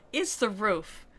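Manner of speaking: usual speaking voice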